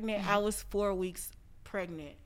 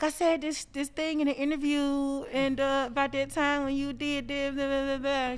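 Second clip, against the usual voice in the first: higher and louder, breezy retelling; chatty recap; 6 semitones higher, 5 dB louder than usual. Retold or imitated. Telling her friend about the interview, breezy. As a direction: rattle it off lightly. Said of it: "I was like".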